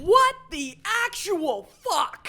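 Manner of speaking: loud, high disbelief; shocked outburst. Real, not acted. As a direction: blurt it out in shock. Player shocked by shapeshift.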